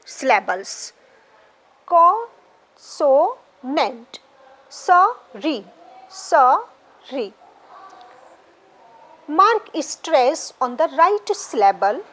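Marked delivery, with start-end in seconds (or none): 1.9-4.2 very slow "Consonant": con, so, nent; "Consonant" in three spaced beats; three separate syllables. Shown as a demonstration. Teacher confirming three syllables, each said apart. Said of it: "So there are three syllables". 4.7-7.3 "sorry" as so, pause, ree, each time; split into syllables. Shown as a demonstration. Teacher dividing words for students, syllable by syllable. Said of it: "divide the following words into syllables".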